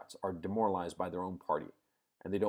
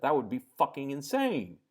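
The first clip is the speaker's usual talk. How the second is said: usual speaking voice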